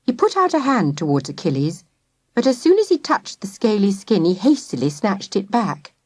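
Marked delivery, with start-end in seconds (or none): none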